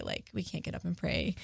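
ordinary talk, nothing marked

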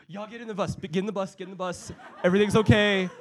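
hammed-up urgency ending in a loud reassurance; urgent bus driver. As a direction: imitate an adult hurrying kids aboard. Bus driver urgently herding the kids.

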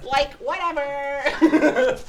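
high, very drawn-out and dismissive; exaggerated slow sass; mock valley-speak. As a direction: stretch it out in a hammed-up bratty whine. Mocks remark about saying like.

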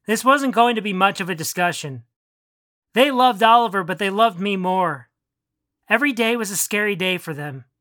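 ordinary delivery, nothing marked

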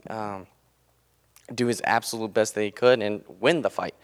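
usual speaking voice

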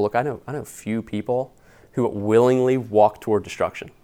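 normal talking voice

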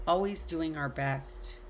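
normal talking voice

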